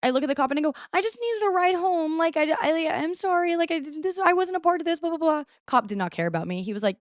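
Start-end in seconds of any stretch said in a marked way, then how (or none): 0.9-5.4 much higher, pleading retold voice; mock pleading. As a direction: plead innocence to the cop, pitched way up. Retold plea to the cop.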